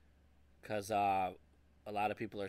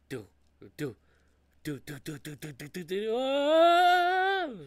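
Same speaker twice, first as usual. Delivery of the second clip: quickening "do, do" taps into a loud high wail; imitated knife-scene stabbing and scream; 9 semitones higher, 8 dB louder, slower than usual. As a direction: tap out the knife rhythm, then break into a long scream.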